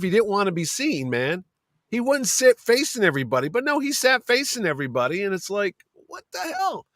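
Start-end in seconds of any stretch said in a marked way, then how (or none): 6.1-6.9 high, soft incredulous voice; hushed, high-pitched mock shock; mock disbelief. Onlookers' shock, voiced in story.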